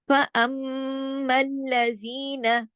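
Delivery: फअम्मल्लज़ीना with a long hummed mm; sustained monotone Quran-lesson recitation; full ghunnah. Shown as a demonstration. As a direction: recite very slowly, holding mm and ज़ी in फअम्मल्लज़ीना. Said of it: "जी दो हरकत खींचा".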